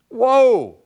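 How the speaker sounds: a high, loud whoa of shock; startled whoa. Real, not acted. Pastor reacting to a harsh verse, high startled whoa.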